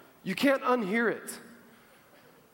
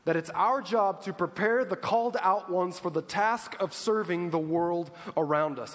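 ordinary delivery

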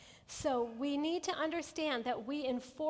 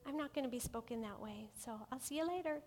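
lovingly; 8 dB quieter, faster than usual. Shown as a demonstration. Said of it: "lovingly say".

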